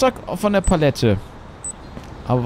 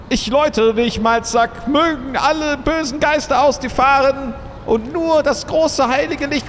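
exorcist; 8 semitones higher than usual. Acted. Mock exorcism ritual.

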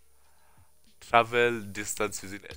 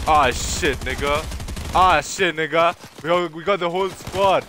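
louder, higher panicked hype; alarmed excitement; 6 semitones higher, 6 dB louder than usual. Real, not acted. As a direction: react as the squad rushes in. Whole squad arriving.

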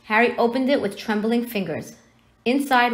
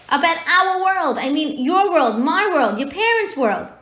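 high, loud exasperated giant; worked-up, forceful giant voice; 8 semitones higher, 5 dB louder than usual. Acted. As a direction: insist on each world in rising indignation. Exasperated giant explains in story.

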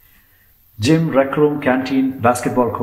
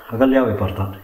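usual speaking voice

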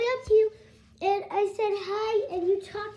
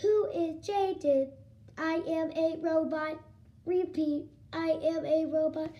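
robot. Acted. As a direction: drone it word by word like a machine. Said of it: "Mommy's a robot too".

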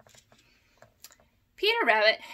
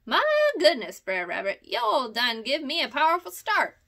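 startled Uncle Billy Possum; 4 semitones higher, 6 dB louder than usual. A narrator's reading. Old possum startled by Peter on the path. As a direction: jump back in a fright, folksy dialect. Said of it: "exclaimed Uncle Billy".

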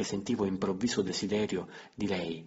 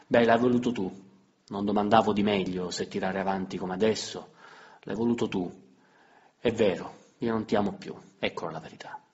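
hard blunt confession; 6 dB louder than usual. Acted. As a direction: throw the truth at him. Emilia confessa con durezza. (confessione dura, voce alta)

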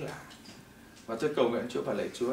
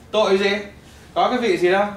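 loud, sharp comeback to his mother; loud defiant reply to a worried mother; defiant retort; 6 semitones higher, 11 dB louder than usual. Retold or imitated. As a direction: fire it back at her, much louder. Defying his mother over religion.

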